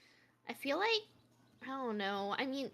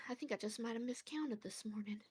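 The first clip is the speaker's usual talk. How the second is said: whisper; 4 semitones lower, 10 dB quieter than usual. Acted. Character mutters aside.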